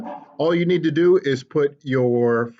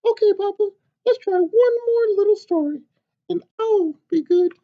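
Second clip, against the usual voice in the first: high, loud, eager chick's squeak; a tiny excited hen butting in; little chicken voice; 3 dB louder than usual. Acted. Chicken bursting into papa's story, high and eager.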